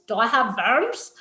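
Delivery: "worms" as "verms", rising sharply; mimicked question ending on a rising "verms" for "worms"; imitated mispronunciation. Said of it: "all I need to say to Dana is".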